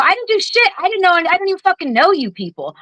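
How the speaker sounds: high-pitched retold protest, pitch climbing way up then settling; indignant retort. Retelling snapping back at the veteran, high and indignant. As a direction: fire back in disbelief, voice jumping high. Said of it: "I go".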